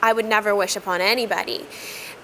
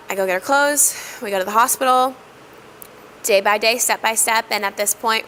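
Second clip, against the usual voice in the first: ordinary talk, nothing marked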